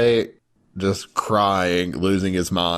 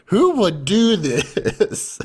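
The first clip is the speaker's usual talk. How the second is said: high, slow disbelieving squeal; who on earth would, stretched out; incredulous; 13 semitones higher, much slower than usual. Retold or imitated. Viewer reacting to the weird gift, high slow disbelief.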